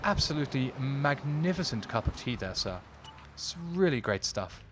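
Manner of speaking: hammed-up upper-class British accent; very posh British accent. Shown as a demonstration. Streamer showing chat what very posh is, mock posh accent. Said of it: "very posh is talking like this".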